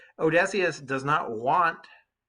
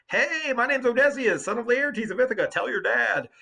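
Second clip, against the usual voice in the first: mock boastful Odysseus; 10 semitones higher, 5 dB louder than usual. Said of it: "he's been like".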